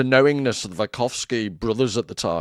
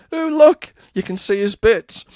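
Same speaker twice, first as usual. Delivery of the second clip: high, delighted mother exclaiming; excited mum; 9 semitones higher, 5 dB louder than usual. Retold or imitated. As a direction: squeal it like an amused mum. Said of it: "my mum ... said".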